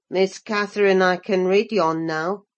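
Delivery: put-on low, clumsy Yorkshire boy, proud of himself; a rough farmhand showing off shyly; awkward, elated lad. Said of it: "with a stupid mixture of awkwardness and elation".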